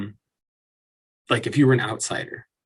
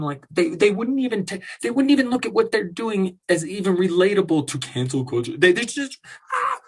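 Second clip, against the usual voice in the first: exasperated; 7 semitones higher than usual. Real, not acted. Rising exasperated rant.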